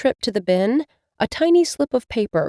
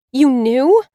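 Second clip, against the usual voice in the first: high, louder indignant outburst; 7 semitones higher, 7 dB louder than usual. Acted. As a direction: accuse him, stung and indignant.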